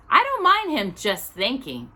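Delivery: a hint of motherly concern; worried Mrs Brown. A narrator's reading. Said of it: "with a worried expression".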